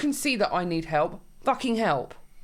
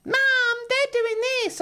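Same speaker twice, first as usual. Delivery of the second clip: put-on very high tattling kid voice; mock kid calling for mum; whining child. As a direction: imitate a child telling tales, pitched way up. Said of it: "one of our kids being like".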